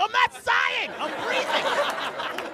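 very high mock-furious yell; comic screech of protest; exasperated shouted denial. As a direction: yell it shrilly, dragging out the last word. Shouted denial in retold quarrel.

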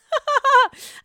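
sudden high laugh at own mix-up; burst of laughter. Real, not acted. Laughing at calling him Craig instead of Paige. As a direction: laugh out loud at the slip.

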